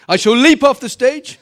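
joke-announce it with a big lift in pitch and volume; playful boast. Pretend. Mock-triumphant boast after healing.

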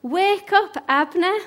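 high, loud wake-up call; acted shout across a distance. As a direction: call to sleepers from a hilltop. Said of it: "he shouted down to the soldiers".

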